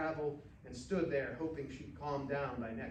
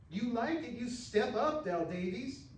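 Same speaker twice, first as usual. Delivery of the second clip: higher and sharp, daring him; angry challenge; 9 semitones higher, 5 dB louder than usual. Acted. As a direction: throw the challenge at him, riled up. Rainey angry in bar quarrel.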